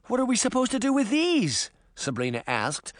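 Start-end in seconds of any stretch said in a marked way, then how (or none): 0.1-1.7 high-pitched startled question; alarmed. A narrator's reading. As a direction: ask it in rising alarm, pitched well up.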